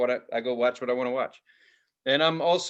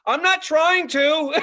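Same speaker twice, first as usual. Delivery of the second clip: high, loud, stretched-out mock protest; pleading protest; 12 semitones higher, 6 dB louder, slower than usual. Accused follower defends himself to couple, high loud mock protest. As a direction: wail it in comic self-defence.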